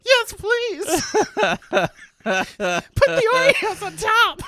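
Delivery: high, giddy laughing swoon. Retold or imitated. Ordering from the hot vendor, giddy swoon.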